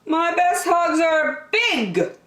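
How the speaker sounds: high, slow little bear voice; high childlike storybook bear; Douglas's voice. Acted. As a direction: sing it out sweetly as young Douglas.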